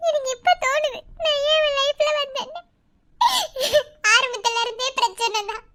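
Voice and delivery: high, tear-choked voice; tearful lament. Acted. Wife lamenting to her sister-in-law, in tears. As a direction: grieve aloud, nearly sobbing.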